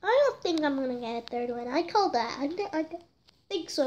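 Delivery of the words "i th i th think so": talking through hiccups; words broken by hiccups. Real, not acted. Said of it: "I got the hiccups".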